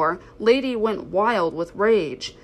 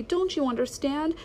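desperate pleading; 8 semitones higher than usual. A narrator's reading. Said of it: "cried Lady".

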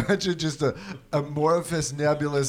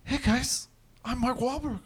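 high, soft celebrity impression; Mark Wahlberg; 8 semitones higher, 4 dB quieter than usual. Host introduces himself as the actor, hushed high impression. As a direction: lift it up, airy and hushed. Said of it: "Why does he whisper?".

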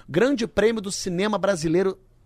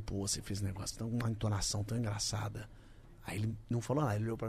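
hushed retelling; 7 semitones lower, 14 dB quieter, faster than usual. Retold or imitated. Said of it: "Aí eu falei".

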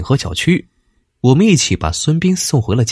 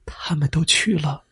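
sorrowful whisper, much softer; 8 dB quieter than usual. A narrator's reading. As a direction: breathe out the bad news gently. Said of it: "轻声而又悲叹地说".

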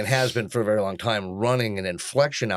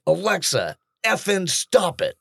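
slow, higher-pitched fed-up order; exasperated; 6 semitones higher than usual. Retold or imitated. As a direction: lose patience and stretch out the command. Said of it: "that's exactly what I said".

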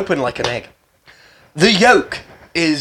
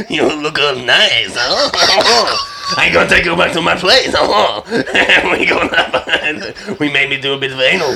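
mock French accent. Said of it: "You do your French one".

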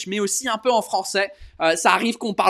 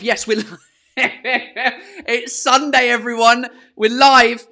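higher, louder, laughing through it; excited, laughing; 4 semitones higher, 5 dB louder, slower than usual. Real, not acted. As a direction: burst out happy the show is on. Excited realizing live.

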